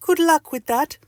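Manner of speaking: very high lady doctor voice; high-pitched, doubtful send-off; Missus Doctor. Acted. Doctor thinks the patient might be crazy, high voice. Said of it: "says Missus Doctor".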